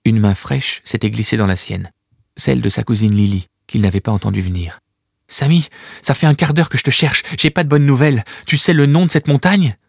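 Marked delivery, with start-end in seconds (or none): 5.3-9.8 put-on higher young girl's voice, a bit urgent. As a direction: play the worried little cousin. Young cousin Lily speaks.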